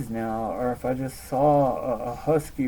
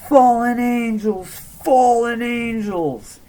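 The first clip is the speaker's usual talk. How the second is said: high, loud, drawn-out cry; imitated freaked-out yell; 10 semitones higher, 9 dB louder, slower than usual. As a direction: mimic a panicked kid yelling out a warning. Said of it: "starts freaking out and he starts yelling".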